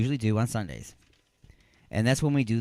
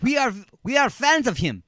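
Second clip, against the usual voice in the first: put-on higher-pitched, poor German accent; badly faked German accent for excited fans; attempted German accent; 11 semitones higher, 4 dB louder than usual. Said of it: "I can't do a German accent".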